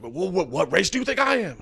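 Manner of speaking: retold heated stranger. Retelling a stranger's race question, mock indignant. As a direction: lightly act out the man's challenge. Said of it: "He was yelling about".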